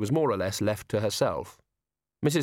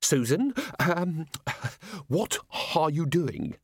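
nervous Mrs Gator; 6 semitones higher, slower than usual. Acted. Nervous employer.

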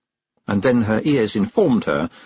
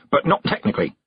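quick; 5 semitones higher, faster than usual. Acted. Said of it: "and added quickly".

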